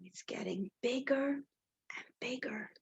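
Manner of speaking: hushed excited whisper. Acted. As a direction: whisper it like a secret. Teacher reading, gasps, whispers.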